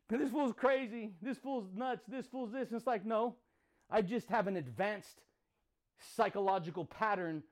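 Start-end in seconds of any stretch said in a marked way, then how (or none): 0.1-2.7 lightly voiced scoffing critics, higher; a touch of sneering doubters; mock critics. Imitating people who say he's crazy, lightly mock scoffing.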